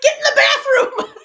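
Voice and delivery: high loud shout through laughter; laughing yell. Retold or imitated. Brothers sending her to the bathroom, high laughing shout.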